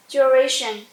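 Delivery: usual speaking voice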